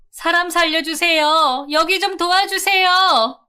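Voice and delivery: very high, loud cry for help; screaming for help. Acted. Wife screaming to neighbours for help, high frantic scream.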